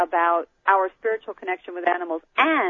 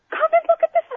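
retold excited shout, much higher and louder; high, loud excited call to husband; 6 dB louder, faster than usual. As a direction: call the husband over in a thrilled rush. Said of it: "So I started screaming".

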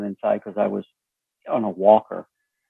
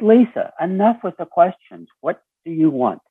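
pitched up high, sharp and fed up; exasperated outburst; 7 semitones higher than usual. Retold or imitated. Retelling snapping at his daughter for interrogating him, exasperated and higher. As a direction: snap at her to stop the questions.

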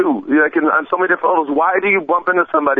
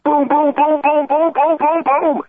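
higher, punchy boom sounds; punch sounds; 11 semitones higher, slower than usual. Retold or imitated. Host acting out the old man's punches, high punchy booms. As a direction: throw each boom like a punch landing.

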